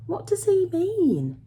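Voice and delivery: high, slow, wondering question; puzzled girl. A narrator's reading. Girl asking the young man about father, high and puzzled. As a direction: ask it in a high girl's voice.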